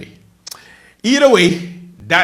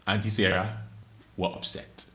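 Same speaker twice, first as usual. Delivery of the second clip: ordinary delivery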